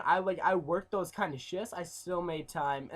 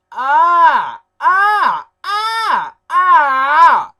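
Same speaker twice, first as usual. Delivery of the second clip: long high exasperated screams, shouted loud; hammed-up wail at being woken; drawn-out cries of annoyance, very loud; mock frustrated scream; 11 semitones higher, 18 dB louder, much slower than usual. Woken up every morning.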